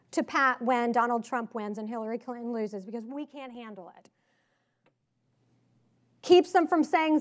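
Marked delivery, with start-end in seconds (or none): 2.8-4.1 much softer, dropped-away voice; hushed mock-fragile aside; mock helplessness. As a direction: say it small, as if too weak to cope. Mocking women's supposed helplessness.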